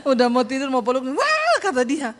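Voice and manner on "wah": high startled yelp; retold sudden scream. Husband about to hug wife at bedtime, high scream. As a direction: imitate a frightened husband crying out. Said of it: "tiba-tiba dia teriak".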